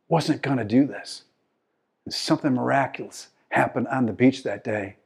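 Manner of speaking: ordinary talk, nothing marked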